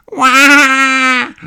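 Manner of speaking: put-on high, loud toddler wail; George's cry. A narrator's reading. George cries as his hat sails away, loud high wail. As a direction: let out a startled little wail as the hat flies. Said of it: "cries George".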